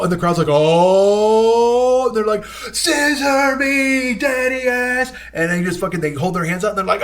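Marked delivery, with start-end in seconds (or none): 0.5-2.1 long, loud, rising crowd "oh"; higher, louder held cry; imitated crowd swell. Crowd answers the build-up. 2.4-5.1 high, very drawn-out wrestler's call; imitated hype-man cry, held long and high; build-up catchphrase shout. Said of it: "like, this long build-up".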